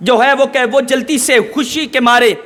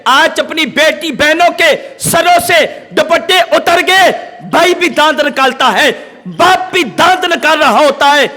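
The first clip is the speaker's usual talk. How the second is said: fiery preaching; 4 semitones higher than usual. Acted. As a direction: preach it hard, scolding the crowd. Preacher rails at society, shouting.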